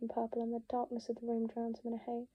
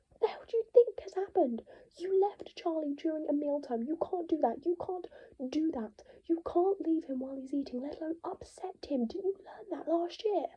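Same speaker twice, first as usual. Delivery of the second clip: much higher and loud; furious rant; 5 semitones higher, 5 dB louder than usual. Acted. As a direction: scold him without pause.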